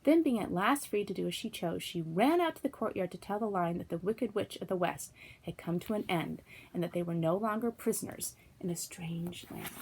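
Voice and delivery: plain reading voice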